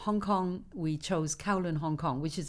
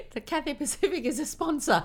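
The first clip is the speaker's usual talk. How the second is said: laughing; 11 semitones higher than usual. Real, not acted. Laughs while naming the sponsor.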